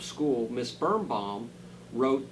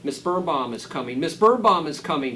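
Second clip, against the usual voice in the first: shouting; 3 semitones higher, 9 dB louder than usual. Acted. Ruby warns the town the teacher is coming, rising to a loud shout. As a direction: raise the alarm, louder the second time. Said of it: "shouted Ruby".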